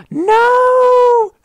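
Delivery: very high, very loud scream of denial; real shriek of no. Real, not acted. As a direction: scream it as you get killed. Dies in the game.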